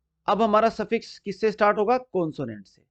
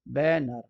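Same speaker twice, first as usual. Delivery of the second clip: Banner slowly split as बै, then नर; two separate syllables; 4 semitones lower, slower than usual. Shown as a demonstration. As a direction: break banner in two with a small gap. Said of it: "दो syllable".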